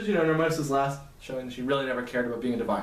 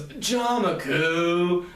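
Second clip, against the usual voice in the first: drawn-out scolding name; 3 semitones higher, much slower than usual. Acted. Akane scolding embarrassed Jamaku.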